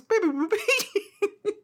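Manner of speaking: high, slow beeping through laughter; laughing robot beeps. Retold or imitated. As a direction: beep while cracking up. Robot noises while laughing.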